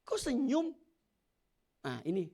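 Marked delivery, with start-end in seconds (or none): 0.0-0.8 puzzled passenger. Retold or imitated. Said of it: "penumpangnya bingung".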